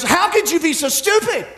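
high-pitched indignant scolding; acted-out marital outrage; exasperated wife. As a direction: scold him in disbelief, pitch jumping up. Wife scolds husband over empty tank.

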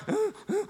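put-on high, soft toddler panic squeals; imitated freak-out. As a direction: mimic a baby's frantic little cries, high. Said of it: "he was freaking out".

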